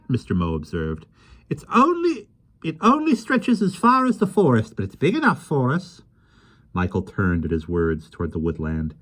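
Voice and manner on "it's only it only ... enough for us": put-on high, lively voice of a small old man; gentle, chatty little fellow; kindly little man. Mister Mo speaks.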